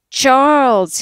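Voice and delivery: a loud, high shout of the name. A narrator's reading. Laurie coming up the hill, shouting news to his mother. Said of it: "he shouted".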